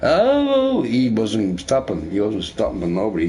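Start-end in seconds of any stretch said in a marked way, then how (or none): 0.0-0.8 very high dog wail; imitated dog crying. Owner shows the dog wouldn't stop, high imitated howl. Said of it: "He's crying".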